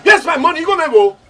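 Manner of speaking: high, loud demand; angry shout. Acted. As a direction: yell for the money. Demands his money back.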